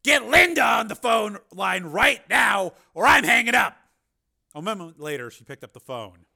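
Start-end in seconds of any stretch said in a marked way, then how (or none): 0.0-3.8 high, very loud demand; angry ultimatum. A narrator's reading. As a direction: bark a last warning down the phone. Steele repeats his ultimatum.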